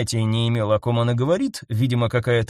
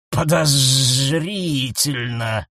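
slow hissed suspicion; much slower than usual. A narrator's reading. Snake husband hisses suspicion at Nyasha. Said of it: "зашипел змей-муж".

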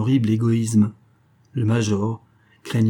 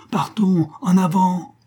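high-pitched rallying call; urgent cry; 9 semitones higher, 3 dB louder, slower than usual. A narrator's reading. Companions on the raft urge departure, crying out. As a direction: call out to get everyone moving. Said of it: "criaient ses compagnons".

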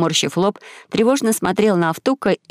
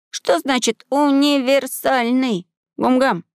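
put-on slow, high-pitched boyish question; high, drawn-out puzzlement; puzzled boy; 6 semitones higher, slower than usual. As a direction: ask slowly in a high young voice. Voices the boy Maxim asking.

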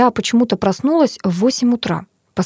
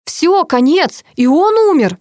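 high, louder outburst; still shouting; 9 semitones higher, 4 dB louder, slower than usual. A narrator's reading. Head of intensive care announces second death, shouting. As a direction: cry out each short phrase. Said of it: "по-прежнему кричал".

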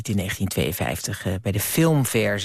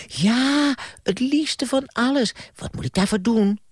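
put-on much higher, excited child voice; childish Bram; 12 semitones higher than usual. Bram eagerly answering Albert Faust, high childish voice. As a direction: gush like a kid who wants it badly.

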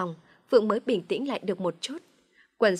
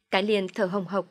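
usual speaking voice

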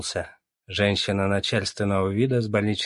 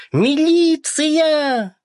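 screaming; 8 dB louder, much slower than usual. A narrator's reading. Someone screams for the police.

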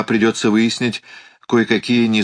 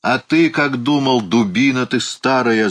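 higher-pitched, scornful exclamation; exasperated shout; 7 semitones higher than usual. A narrator's reading. Alan berating the old uncle, mildly shouted exasperation. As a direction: scold him with a restrained shout. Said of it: "закричал Аллан".

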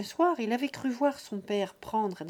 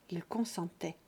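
plain reading voice

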